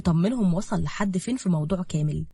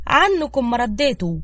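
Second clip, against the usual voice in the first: slow, higher huff of irritation; peeved and dragging, voice raised; annoyed, to herself; 5 semitones higher, 5 dB louder than usual. A narrator's reading. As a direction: grumble inwardly with drawn-out annoyance. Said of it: "وقالت في سرها بضيق".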